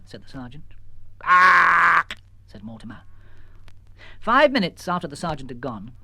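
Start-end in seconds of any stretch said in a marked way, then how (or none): 1.2-2.2 a sharp, loud squawk; loud bird squawk. Acted. Mortimer squawking at the sergeant. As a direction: bark it like a bird.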